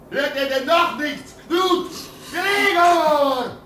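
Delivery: put-on old man calling the boys to the door. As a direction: shout for the others to come. Calling Knut and Gregor outside.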